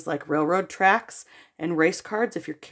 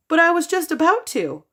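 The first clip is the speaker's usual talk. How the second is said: put-on whiny child protest; 10 semitones higher, 3 dB louder than usual.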